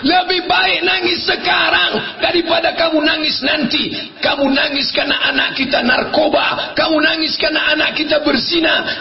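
high, insistent acted tirade; stern scolding. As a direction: drive each reason home in a high voice. Husband scolds his crying wife. (suami memarahi istri, bernada tinggi)

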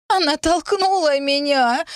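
put-on very high, drawn-out child's wail; sobbing wail. A narrator's reading. Girl points at the heroine, sobbing. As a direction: wail the accusation in a tearful whine. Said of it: "рыдала девочка".

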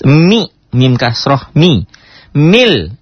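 "mi" then "Mil" rising in a spelling drill; syllable drill. Shown as a demonstration. Teacher drilling syllables for the students, slow and rising. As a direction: spell "mi, mim kasroh, mi. Mil" syllable by syllable, slowly. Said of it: "kalau menemukan tasydid dia apa? Dihentak".

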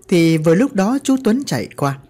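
plain reading voice

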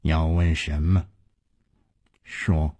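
low, quiet, terse demand; subdued, low-pitched command to speak; deep, grave voice. A narrator's reading. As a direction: drop the voice and ask gravely. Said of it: "终于沉声说".

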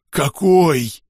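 greedy interest. A narrator's reading. Axolotl porter asks which book, greedily curious. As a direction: pounce on the detail eagerly. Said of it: "жадно поинтересовался Ахилот".

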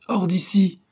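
high, loud angry command; peremptory order. A narrator's reading. Employer ordering the chauffeur out, peremptory shout. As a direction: throw him out with a sharp shout. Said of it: "intima sir Felton".

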